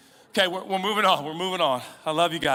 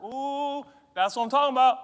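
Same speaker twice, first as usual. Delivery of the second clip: higher, louder gleeful cheer; delighted; 9 semitones higher, 7 dB louder than usual. Retold or imitated. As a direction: crow with delight. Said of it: "You're like".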